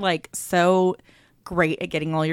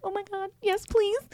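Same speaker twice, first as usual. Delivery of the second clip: squealing delight; 14 semitones higher than usual. Retold or imitated. Thrilled about goat painting.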